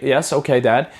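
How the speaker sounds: ordinary delivery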